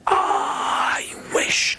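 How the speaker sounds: long breathed-out whisper; magical wishing. Acted. Wife making a magic wish, long hushed whisper. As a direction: stretch the first word into an airy whisper.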